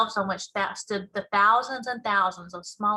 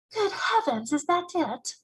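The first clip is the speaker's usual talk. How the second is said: put-on surprised girl; 6 semitones higher than usual. Sophie surprised.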